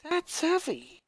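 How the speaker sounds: a friend stunned by hard news; character voice. Acted. Character dialogue voiced by reader.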